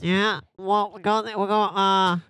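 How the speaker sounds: high, slow nasal Australian; mock Australian accent. Co-host introducing news, put-on Australian accent. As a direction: drag it out in a pinched Aussie twang. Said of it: "My nose is sore now".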